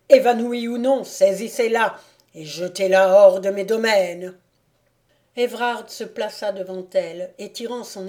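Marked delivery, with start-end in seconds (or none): 0.1-4.4 loud, forceful command; angry order. A narrator's reading. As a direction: drive the servants on with a raised voice. Enraged count orders his valets.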